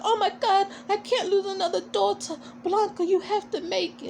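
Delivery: high, pleading mock panic; desperate mother. Miss Electra begging Blanca to survive, high panicked plea. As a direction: cry out like a mother losing her daughter.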